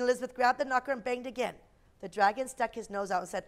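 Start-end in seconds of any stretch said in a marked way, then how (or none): none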